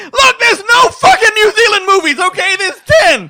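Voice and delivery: high, loud outburst of protest; exasperated shout. Real, not acted. Defending a dubious list pick to co-hosts, exasperated shouting.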